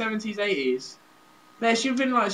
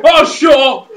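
loud, higher shouted retort; northern husband; 7 semitones higher, 14 dB louder, slower than usual. Acted. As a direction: yell back as the mock northern husband. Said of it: "And he's like".

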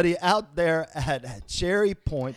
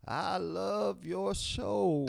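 put-on old man voice; 5 semitones higher, 6 dB quieter, much slower than usual. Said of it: "He was as old as Father Time".

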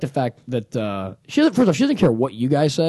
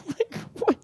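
laughing; 6 dB quieter than usual. Real, not acted. Laughing after the impression.

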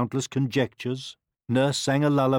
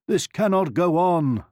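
a hint of a king, higher and louder; lightly voiced king; 7 semitones higher, 5 dB louder than usual. A narrator's reading. King fed up with sleepless nights, declaring. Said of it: "said the king".

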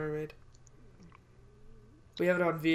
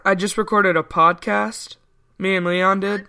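repeated louder for a bad line; loud, clear into phone; 2 semitones higher, 12 dB louder than usual. Real, not acted. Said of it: "What did you say?".

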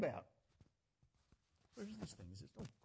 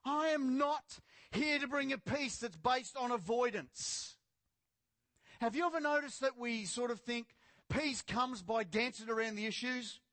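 firm, projected pulpit delivery; emphatic preaching; 4 semitones higher, 4 dB louder, slower than usual. Real, not acted. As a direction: press the point on the congregation. Preaching at full force.

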